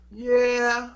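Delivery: high, loud yeah; emphatic high yeah. Retold or imitated. Grandson eagerly answers grandma, loud and high.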